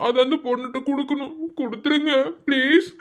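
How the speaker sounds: a nervous junior begging the senior; pleading timid student. Acted. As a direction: plead meekly to get the letter back. Timid student begs for letter.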